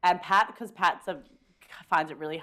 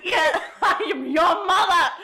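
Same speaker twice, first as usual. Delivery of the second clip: higher, loud, slowed, laughing; laughing repeat; 12 semitones higher, 10 dB louder, slower than usual. Acted. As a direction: echo the line, laughing harder. Repeating friend's line.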